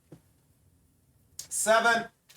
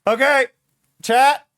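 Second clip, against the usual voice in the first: loud, higher, grabbing attention; loud call to chat; 5 semitones higher, 7 dB louder, slower than usual. Real, not acted. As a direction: shout to get chat listening. Calling chat's attention.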